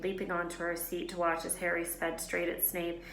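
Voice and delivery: usual speaking voice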